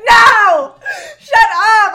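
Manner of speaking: loud, high outcry of protest; real shout. Real, not acted. Shouting at Diego to stop the Southern accent. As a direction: yell to cut the Southern bit off. Friend cuts off the Southern bit.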